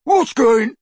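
high, loud taunting imitation; mocking mimicry. A narrator's reading. Crabbe copies Ron's question, mocking mimicry. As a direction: parody the friend's shouted question. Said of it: "mimicked Crabbe".

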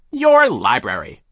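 loud, slow, scornful snap; sharp, drawn-out dismissal; sharp retort. A narrator's reading. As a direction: cut in sharply as the boss. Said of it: "the man said sharply".

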